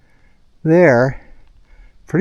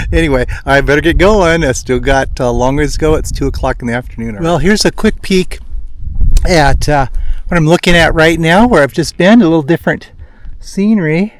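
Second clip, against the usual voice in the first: ordinary talk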